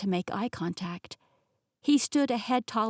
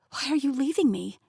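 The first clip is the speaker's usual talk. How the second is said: ordinary delivery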